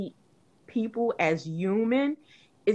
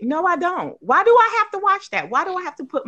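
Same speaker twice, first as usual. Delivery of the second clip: high, loud indignant refusal; exasperated outburst; 9 semitones higher, 4 dB louder, faster than usual. Real, not acted. Refusing people who insist she watch, exasperated. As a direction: push back hard, fed up. Rejecting being told to watch videos.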